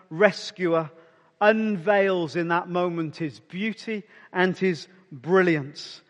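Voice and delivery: usual speaking voice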